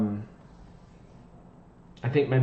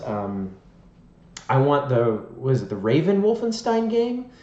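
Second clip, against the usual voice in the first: usual speaking voice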